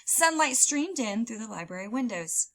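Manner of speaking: usual speaking voice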